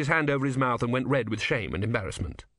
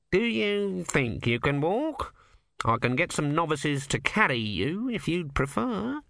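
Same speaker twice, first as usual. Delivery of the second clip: lightly voiced nervous cleric, raised pitch; a fussy young monk, pitched up; high fussy monk; 6 semitones higher than usual. A narrator's reading. Numrod voiced by narrator.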